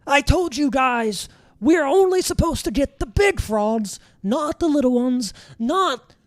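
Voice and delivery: high, exasperated little guard voice; high scolding beet guard. Acted. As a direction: scold the two helpers in a squeaky voice. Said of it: "He's like".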